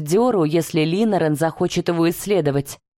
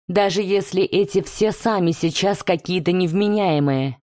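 ordinary delivery

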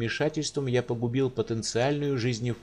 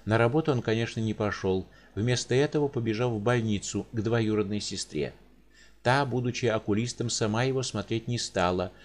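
plain reading voice

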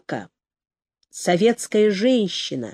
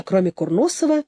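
usual speaking voice

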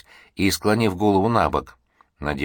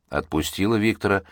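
ordinary delivery

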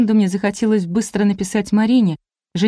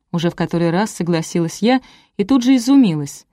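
usual speaking voice

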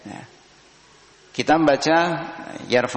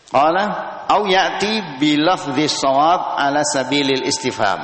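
slow formal reading of the Arabic; formal kitab reading; text recitation; 4 semitones higher, 3 dB louder than usual. Acted. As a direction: read it out like the book.

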